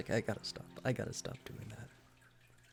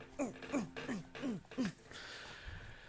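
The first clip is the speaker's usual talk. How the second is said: long exasperated sigh; 13 semitones higher, 4 dB quieter than usual. Retold or imitated. Exasperated sigh ending retold scene.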